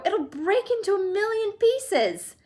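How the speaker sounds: high, slow, fearful wail; panicked. A narrator's reading. Grandpa Joe warning Mr Wonka, high fearful wail. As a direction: dread in every word.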